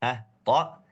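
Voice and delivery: normal talking voice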